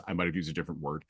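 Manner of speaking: ordinary talk, nothing marked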